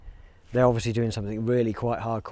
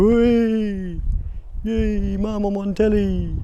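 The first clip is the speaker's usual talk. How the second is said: hoog en luid, een uitgelaten voorbijganger die in beeld springt; imitating a telly-crasher; 9 semitones higher, 5 dB louder, much slower than usual. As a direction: roep het als een opgewonden fan achter de verslaggever. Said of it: "jump up behind them and go".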